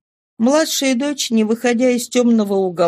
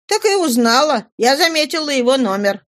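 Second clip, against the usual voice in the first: put-on high-pitched young woman's answer; daughter's retort; 5 semitones higher than usual. Daughter explains to her father, high-pitched retort.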